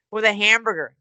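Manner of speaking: ordinary talk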